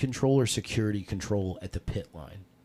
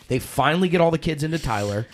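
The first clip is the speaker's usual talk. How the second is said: normal talking voice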